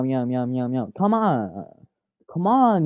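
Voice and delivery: usual speaking voice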